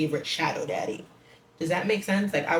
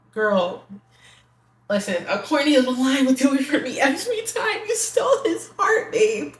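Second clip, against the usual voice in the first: higher, louder giddy laughing; laughing gush; 8 semitones higher, 5 dB louder than usual. Real, not acted. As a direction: giggle through the swoon. Swooning over a corny line.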